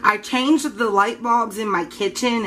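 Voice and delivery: normal talking voice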